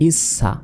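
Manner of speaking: ইস সা as is-sa, the hiss held across; doubled consonant. Shown as a demonstration. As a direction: sound the s at both ends in ইস সা. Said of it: "দুইবার উচ্চারণ হবে". (ইস সা, স দুইবার)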